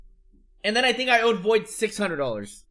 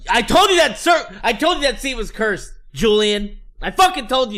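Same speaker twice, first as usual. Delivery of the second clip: loud, high, heated yell; 4 semitones higher, 6 dB louder than usual. Retold or imitated. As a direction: yell it at him, fed up. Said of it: "I was like".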